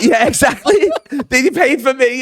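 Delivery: high, laughing agreement. Real, not acted. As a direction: crack up while saying it. Laughing at his own story.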